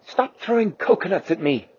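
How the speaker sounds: a lightly voiced scolding mother; mildly scolding like a mom; mother's voice. The T-Rex, lightly voiced as a mother, telling Quasi to stop. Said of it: "in a mother's voice".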